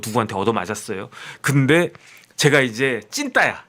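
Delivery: normal talking voice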